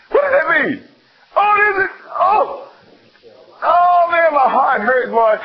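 overcome with fervor. Real, not acted. Preacher to the congregation, carried away with fervor. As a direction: let it pour out of you, carried away.

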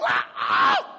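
loud, startled double scream; horrified scream. Acted. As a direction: scream out in escalating alarm. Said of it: "when he saw the seventh, we go".

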